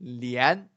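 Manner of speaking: "Lian" with a rising tune; second tone lian. Shown as a demonstration. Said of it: "how I gonna read it".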